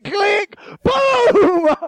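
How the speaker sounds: high shouted click, boom; gunshot sound effect. Retold or imitated. Telling the crowd about shooting, a shouted mock gunshot. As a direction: shout the boom like a gun going off. Mimicking the gun firing.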